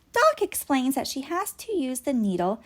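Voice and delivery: plain reading voice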